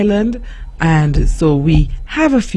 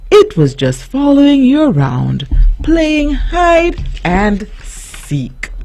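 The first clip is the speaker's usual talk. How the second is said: playful teasing voice; 8 semitones higher than usual. A narrator's reading. Capital I playful.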